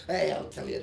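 usual speaking voice

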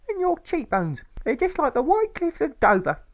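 very high, loud, sing-song mother insisting; a grieving mother clinging to hope; tearful mother. A narrator's reading. Mother reassures her son about his father, high and loud.

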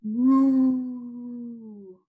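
a big lowing cow moo; loud moo. Shown as a demonstration. Teacher leading first graders in animal sounds, loud moo. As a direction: moo it out for the class.

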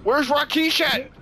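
loud, high urgent calling; yelling across the room. Real, not acted. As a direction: shout for the missing friend. Looking for teammate urgently.

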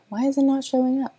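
puzzled. Retold or imitated. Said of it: "I'm over here like".

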